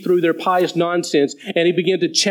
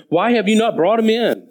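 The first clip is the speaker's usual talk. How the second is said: raised, pointed question of a priest; demanding Pharisee; 5 semitones higher than usual. Acted. Chief priests scold the officers, demanding. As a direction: press the officers like an annoyed Pharisee. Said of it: "who said to them".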